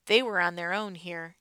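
ordinary delivery

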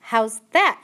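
loud shouted question; shouting. A narrator's reading. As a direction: shout it, half-deaf and puzzled. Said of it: "shouted Mig".

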